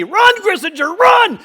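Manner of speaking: high frantic coach shout; yelling. Retold or imitated. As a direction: urge him on at full voice. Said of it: "the coach yelled at me".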